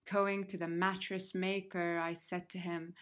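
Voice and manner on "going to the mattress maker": ordinary delivery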